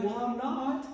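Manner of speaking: high, protesting dad voice; mock a husband's exasperated defence; imitating dad. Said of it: "Dad would be like".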